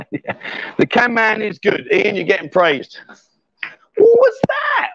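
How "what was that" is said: surprised shout. Real, not acted. Sudden shriek from the players.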